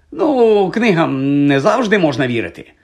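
plain reading voice

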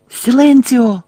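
high, loud spell cry; крик. A narrator's reading. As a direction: shout the silencing spell sharply. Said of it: "крикнула Герміона". (гучне високе закляття)

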